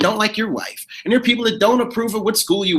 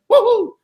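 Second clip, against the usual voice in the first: whoop; 13 semitones higher, 4 dB louder than usual. Pretend. Cheer after the song.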